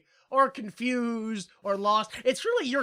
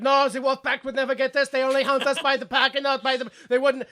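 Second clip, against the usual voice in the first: put-on higher, louder, insistent German accent; mock German accent; 4 semitones higher, 5 dB louder than usual. Lancer corrects the major on wolf packs, mock German accent. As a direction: protest too knowingly in stage German. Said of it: "sound like you command a U-boat".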